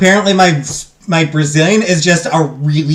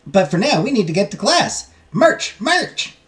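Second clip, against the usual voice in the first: higher-pitched character read, rising on the order; game character voice; 6 semitones higher than usual. Acted. Game character sending new students to class, high and commanding.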